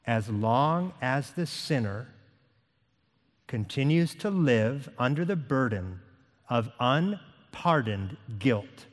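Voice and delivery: unhurried and low, phrase by phrase; slow reading. Real, not acted. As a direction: read it slowly, with long pauses between phrases. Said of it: "I'm gonna read it slowly".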